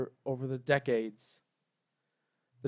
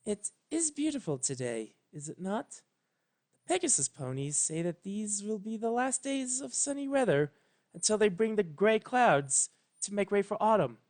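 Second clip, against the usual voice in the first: gentle regal princess; 6 semitones higher than usual. A narrator's reading. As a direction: make small talk like a serene queen. Celestia voiced in story.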